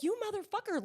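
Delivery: very high, indignant disbelief; high-pitched mock outrage. Host retells feeling betrayed as a viewer, high mock outrage.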